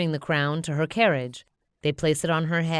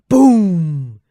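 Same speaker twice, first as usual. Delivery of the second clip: big booming crash word; loud boom; 14 dB louder than usual. A narrator's reading. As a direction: shout the impact. Crash into a tree.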